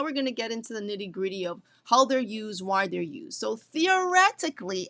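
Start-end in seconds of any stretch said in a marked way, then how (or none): 3.6-4.9 theoretically punched high and loud; emphasis on theoretically. Shown as a demonstration. Teacher warning students about tariffs, theoretically stressed high and loud. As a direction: lean hard on theoretically, pitched up. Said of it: "I put a big emphasis on the word theoretically".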